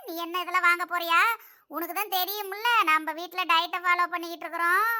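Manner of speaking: ordinary delivery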